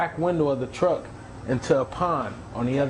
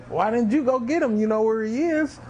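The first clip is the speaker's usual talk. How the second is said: exasperated; 8 semitones higher than usual. Retold or imitated. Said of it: "he said to himself".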